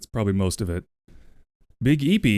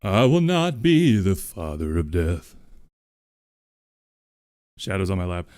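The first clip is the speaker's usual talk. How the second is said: Doctor Light voice; slower than usual. Acted. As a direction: sing it as the sorrowful inventor. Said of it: "the Doctor Light voice".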